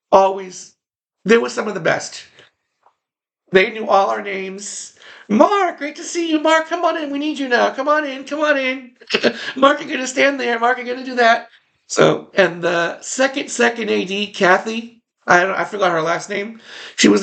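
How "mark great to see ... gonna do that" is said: high, bright voice of a busy assistant director; friendly AD. Retold or imitated. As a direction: greet him warmly and hurry him onto set. Assistant directors greeting him.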